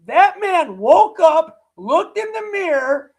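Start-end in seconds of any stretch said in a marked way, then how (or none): none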